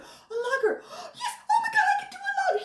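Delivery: very high, gasping mock delight; hammed-up giddy excitement, breaking into laughter; excited. Imitating a man thrilled at a drink order he can do, excited. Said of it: "He was so excited".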